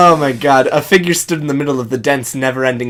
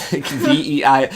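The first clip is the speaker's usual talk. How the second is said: ordinary talk, nothing marked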